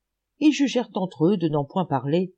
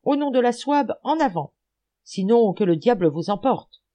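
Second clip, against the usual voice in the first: raised, rousing charge call; battle cry; 6 semitones higher, 4 dB louder than usual. A narrator's reading. Vilti in the rear, eager to attack, battle cry. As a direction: urge the troop forward with force. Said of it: "brûlait d'attaquer et cria".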